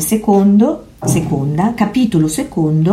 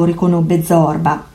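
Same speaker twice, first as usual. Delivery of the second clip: ordinary delivery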